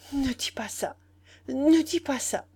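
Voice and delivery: catching on the first sound, rising in distress; stammered plea. A narrator's reading. The boy begs Nana to stop, faltering. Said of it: "en bégayant".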